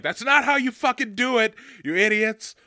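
yelled high and loud at the idiots; angry outburst at screen. Retold or imitated. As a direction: yell at the screen in disgust. Ranting at movie characters.

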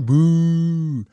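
imitated crowd boo. Mimicking the crowd booing.

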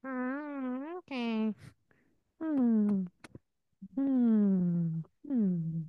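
very high, strained cartoon cat squeal; a cat squeaking up high, insisting on Lyft. Role-play cat refusing the Uber, in a very high squeaky voice.

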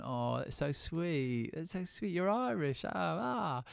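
high, loud, amused mock English tourist; laughing English tourist. Retelling the tourist who laughed at him, amused and high. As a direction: coo over him like a delighted tourist. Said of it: "And he was going".

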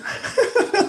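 real high-pitched laugh. Real, not acted. Podcaster laughing at his own campaign joke, amused.

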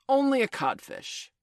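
scornful, high and loud; mocking. A narrator's reading. Peter mocking Hook.

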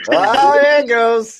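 high and loud, through laughter; laughing. Real, not acted. Laughing as friend reacts.

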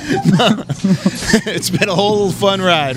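slow, high, laughing tease. Real, not acted. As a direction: chuckle through the words. Teasing, laughing.

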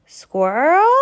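high, loud call up into the tree. Acted. Porcupine calls up to Squirrel's tree, a loud high call. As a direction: call up to Squirrel in her oak. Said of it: "Porcupine called".

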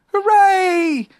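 high, loud storybook cheer; shouted cheer. A narrator's reading. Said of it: "you shouted".

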